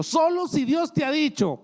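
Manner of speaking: sudden high loud stress on solo; emphatic warning. Retold or imitated. Wife's warning voiced in story.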